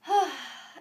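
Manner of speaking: long audible exhale; big cleansing sigh out; deep sigh. Real, not acted. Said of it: "And breathe".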